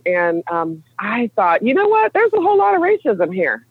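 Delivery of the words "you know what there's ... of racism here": much higher-pitched retold epiphany; naive realization. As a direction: act out a naive sudden discovery, pitched way up. Acts out her naive past realization.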